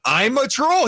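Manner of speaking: high mock voice. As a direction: quote him in a squeaky high voice. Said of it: "He said".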